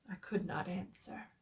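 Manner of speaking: ordinary delivery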